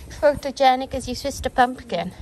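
normal talking voice

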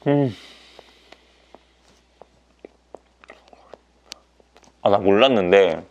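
talking while chewing food; muffled behind hand over mouth; mouth full, chewing. Real, not acted. Seen 0: Hand covers mouth mid-chew after bite; face turns down later.

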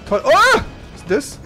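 usual speaking voice

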